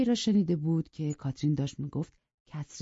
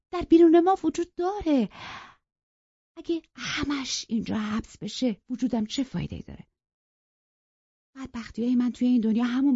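heartfelt confession to Nelly; passionate Catherine; 8 semitones higher, 4 dB louder than usual. Acted. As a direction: voice swelling with feeling, louder at the peak.

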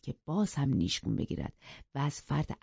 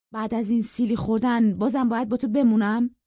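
raised, affronted voice; indignant question; 6 semitones higher, 6 dB louder than usual. Acted. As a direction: protest loudly at being expected to stay. Slapped suitor protests in quarrel.

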